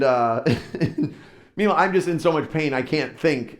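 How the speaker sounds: plain reading voice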